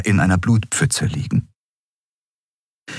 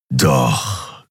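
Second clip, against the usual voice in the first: low growled word of a big cat warrior; 5 semitones lower than usual. Acted. Feline warrior answers his attacker with a low growl. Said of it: "knurrte Keru".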